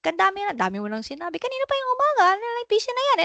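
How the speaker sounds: high, fast exasperated scolding; nagging parent. Acted. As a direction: rattle off orders like an irritated mom. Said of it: "emotionally charged ka ba when you give orders".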